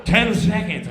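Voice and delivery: loud countdown shout; countdown call. Retold or imitated. Stage manager counting down, loud call. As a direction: bark the time left.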